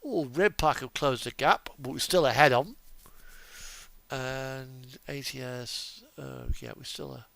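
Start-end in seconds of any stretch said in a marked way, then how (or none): none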